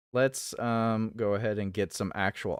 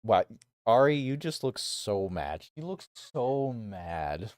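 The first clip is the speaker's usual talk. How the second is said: ordinary delivery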